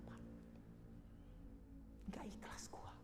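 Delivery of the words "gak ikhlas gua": very soft, voiceless whisper; reluctant dying parent. Acted. Dying parent unwilling to let go, faint whisper. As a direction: breathe it out with hardly any sound. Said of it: "seperti gak ikhlas banget".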